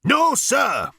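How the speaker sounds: high, loud barked answer; military shout. A narrator's reading. Number Two answering the captain, shouting. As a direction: bark it like a soldier.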